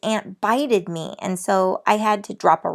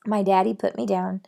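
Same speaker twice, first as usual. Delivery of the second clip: ordinary delivery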